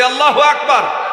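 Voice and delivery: Allahu Akbar called out high and loud. Real, not acted. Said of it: "জোরে বলি".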